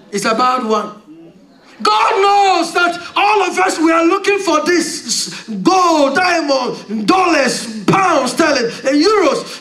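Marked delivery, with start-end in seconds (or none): 1.8-9.6 preaching at full force. Acted. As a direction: drive it home from the pulpit.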